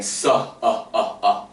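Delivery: "su, ah" with an added ah after the s; extra ah vowel. Shown as a demonstration. Teacher showing the common mistake, adding a vowel. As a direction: tack an ah onto the s sound. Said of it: "I'm putting an extra ah at the end of the sound".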